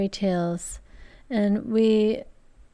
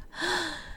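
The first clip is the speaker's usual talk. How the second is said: gasp; 9 semitones higher, 9 dB quieter than usual. A narrator's reading. Sister sees brothers coming.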